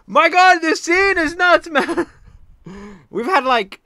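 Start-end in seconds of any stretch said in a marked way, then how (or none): 0.1-2.1 loud, high and slowed, half-laughing disbelief; stretched-out loud amazed cry with a laugh; excited outburst. Real, not acted. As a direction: shout it like the scene blew your mind. Reacting to wild scene.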